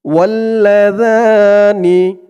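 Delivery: slow melodic tajweed chant, drawn-out vowels; Quran recitation. Shown as a demonstration. Teacher recites the verse for viewers, in slow melodic chant. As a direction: recite it like tilawat, stretching every long vowel.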